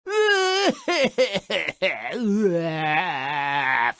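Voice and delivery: loud gleeful cackle; a long wicked cackle of delight; cackling laugh. Real, not acted. Narrator cackling at Jack's bad sale, gleeful cackle. Narrator enjoys mother's fury.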